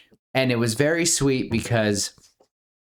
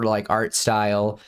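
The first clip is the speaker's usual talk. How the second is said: ordinary talk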